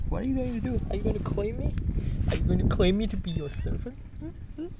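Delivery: very high playful coo. Real, not acted. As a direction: pretend the cat rules you. Baby talk to a cat.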